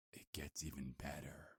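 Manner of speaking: "It gets even better" whispered as a teasing secret. Acted. Werewolf teasing the captive with a worse secret, whispered. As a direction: lean in and hush it.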